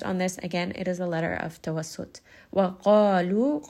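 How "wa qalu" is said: Wa qalu very slow, heavy qaf held two counts; heavy two-count mad. Shown as a demonstration. Tajweed teacher modelling mad lengths, slow recitation. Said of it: "qaf is a two count heavy mad".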